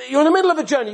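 high, loud insistence; urgent plea. Retold or imitated. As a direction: press him to stay. Rabbi urging student to stay.

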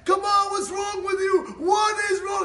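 high, furious acted shouting; loud, enraged tirade; screaming husband. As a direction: yell at the top of your lungs, stretching the words. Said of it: "He starts screaming on top of his lungs".

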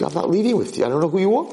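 acted alarmed refusal; indignant refusal. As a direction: refuse the stranger, voice rising. Boy refuses stranger.

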